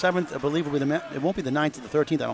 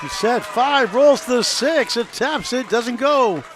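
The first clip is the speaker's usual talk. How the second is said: excited call; 7 semitones higher, 6 dB louder, slower than usual. Real, not acted. Calling a close pin action.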